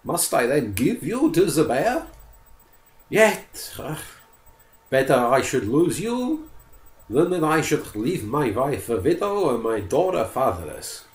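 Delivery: a mild touch of grief, thinking aloud; sorrowful forester. Acted. Forester grieving for poodle.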